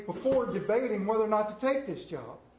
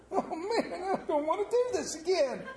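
weary whine; 9 semitones higher than usual. Pretend. Acted weary worshipper complaint.

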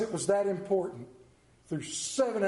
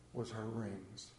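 1 hushed reveal; 11 semitones lower, 15 dB quieter, slower than usual. Acted. Climax of the find.

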